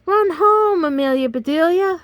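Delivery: high, loud urging shout; frantic cheering shout. Acted. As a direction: scream her home from the dugout. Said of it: "shouted the boys".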